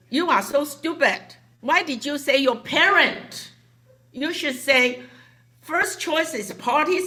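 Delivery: loud, high teasing rebuke; mock scolding. Boss teasingly scolding Tina about her answer, loud. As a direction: tell her off with a laugh. Scolding employee in retold talk.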